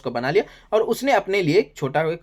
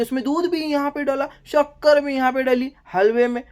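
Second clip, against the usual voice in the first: mock Kunika stirring; 7 semitones higher than usual. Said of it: "बोलती है".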